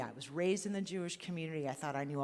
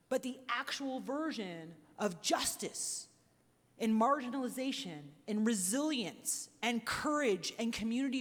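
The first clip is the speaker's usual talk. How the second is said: raised, searching questions to the room, building; impassioned appeal; 4 semitones higher than usual. Real, not acted. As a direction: preach the questions out to the audience. Rising preacher-like appeal.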